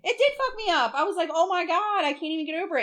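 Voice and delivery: high-pitched, worked-up exclamation; emphatic distress. Retold or imitated. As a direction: retell being overwhelmed, pitch way up. Retelling how upset she was.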